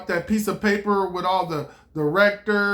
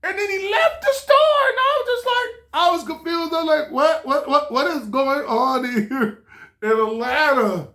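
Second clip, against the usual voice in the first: incredulous; 7 semitones higher than usual. Retold or imitated. Incredulous high retelling.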